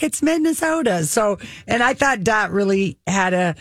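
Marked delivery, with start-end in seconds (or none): 0.0-1.0 put-on "Minnesota" said high in a sing-song Minnesota accent. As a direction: chirp it in Minnesota accent, much higher. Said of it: "she's like".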